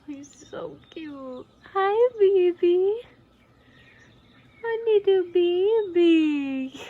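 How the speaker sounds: high, slow cooing greeting; sing-song hello to a tiny pet. Real, not acted.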